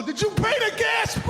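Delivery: very high, loud mock wife yelling; mock nagging spouse. Husband mocking chat with wife during spin, high loud mock yell. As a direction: spoof a shrill household question mid-workout. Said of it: "I'm not gonna turn to you and go".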